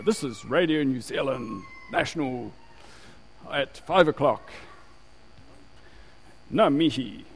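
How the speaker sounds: imitating a formal station announcement; measured radio presenter impression, carefully placed words; old radio announcer. Preacher mimics the old Radio New Zealand ident, formal announcer voice. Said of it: "they always used to just have ... then they go".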